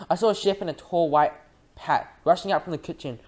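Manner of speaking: usual speaking voice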